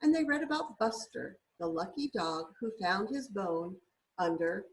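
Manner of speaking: gentle, lilting picture-book reading; storybook read-aloud voice; reading to children. Acted. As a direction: read it like a bedtime story for a little one.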